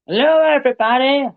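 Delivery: playful squeaky greeting; high put-on voice. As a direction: chirp it like a cartoon doctor.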